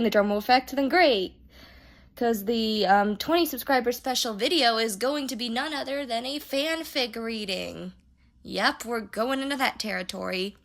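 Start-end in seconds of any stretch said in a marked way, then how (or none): none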